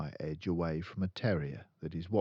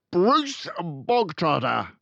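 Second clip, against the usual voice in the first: much louder, higher bark of a name; 12 semitones higher, 11 dB louder, slower than usual. Acted. The headmistress summoning a boy, with a loud bark. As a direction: bark the name like a drill sergeant. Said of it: "the Trunchbull barked".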